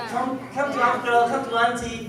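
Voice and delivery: high, cooing call to a small niece; a lightly voiced doting uncle coaxing kids; approachable coaxing. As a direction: coax the little ones over like a doting uncle. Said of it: "the most approachable face".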